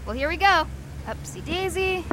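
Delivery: ordinary talk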